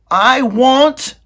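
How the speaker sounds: high, loud wail; crying out. Acted. Meg crying out to the princess, high and loud. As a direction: cry it out desperately. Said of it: "cried Meg".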